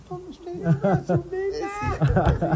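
a hint of holding his breath; handing back. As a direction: mimic hurriedly giving back the baby. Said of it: "cuando el gordo la devolvió así".

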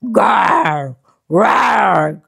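loud playful monster growl; scary monster roar. Acted. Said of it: "Can you do a scary monster roar?".

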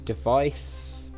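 normal talking voice

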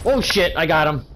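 surprised. Real, not acted. Surprise kill in firefight.

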